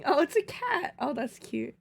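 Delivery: ordinary talk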